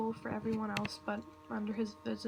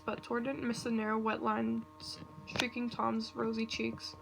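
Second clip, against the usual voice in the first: ordinary delivery, nothing marked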